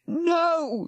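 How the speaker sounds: high desperate wail. Acted. Old lady wailing as fingers close on her neck. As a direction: cry out as he grabs you. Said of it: "she wailed".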